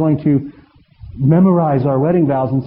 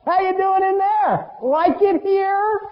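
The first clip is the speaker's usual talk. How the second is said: high, sneering call from outside the pig farm; mock the boy slowly as you pass; taunting father; slower than usual. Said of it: "walk by the pig farm going".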